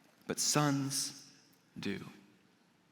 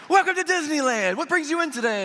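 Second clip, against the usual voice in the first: high, exaggerated theme-park welcome; over-the-top happy; 11 semitones higher, 4 dB louder than usual. Disney attendant welcoming a driver at the gate, over-the-top happy. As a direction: gush like a hammed-up cheerful attendant. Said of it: "he's way over the top happy".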